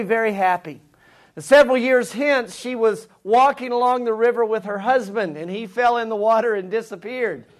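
ordinary talk, nothing marked

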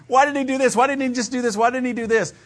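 put-on high, insistent childlike voice; child's questions. Pastor mimicking his son's endless questions in a high childlike voice. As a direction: rattle the questions off like a curious kid.

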